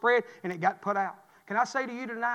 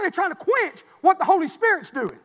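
fervent preaching; 8 semitones higher, 6 dB louder than usual. Acted. First statement of the point.

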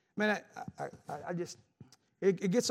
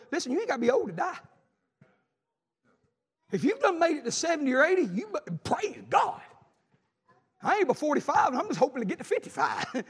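high-pitched, emphatic exhortation; fervent preaching; 8 semitones higher, 5 dB louder than usual. Real, not acted. Preacher warning congregation you needn't be old to die, fervent preaching. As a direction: preach it urgently, pitch pushed well up.

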